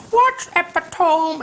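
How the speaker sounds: high, slow mocking "What epitome?"; oversized mockery. Rival girl ramming her point home, high and slow. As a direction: ham up the rival's sneer. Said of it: "she did a big oversized gesture".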